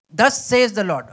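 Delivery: loud, solemn proclamation of scripture; scripture reading. Shown as a demonstration. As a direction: announce the verse with weight. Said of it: "Let me read this".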